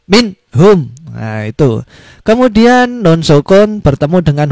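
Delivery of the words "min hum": min hum quick and crisp; fast, short, clear. Shown as a demonstration. Teacher modelling the fast, short, clear reading. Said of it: "Cepat, pendek, dan jelas".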